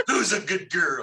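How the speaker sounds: talking to a puppy, sweet and high; dog baby talk. Acted. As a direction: coo at her like a pet dog. Petting the Chihuahua paladin.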